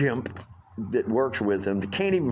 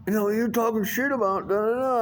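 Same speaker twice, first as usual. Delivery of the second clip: higher put-on voice trailing into nonsense; mocking busboy voice; 10 semitones higher than usual. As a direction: parody his accusation. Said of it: "busboy reply quoted".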